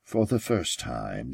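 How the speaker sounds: ordinary delivery, nothing marked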